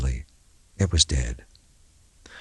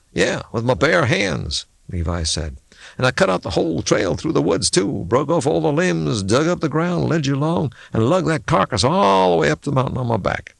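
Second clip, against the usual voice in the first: sarcastic; 7 semitones higher than usual. A narrator's reading. Levi sarcastic reply.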